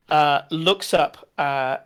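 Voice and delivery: ordinary talk, nothing marked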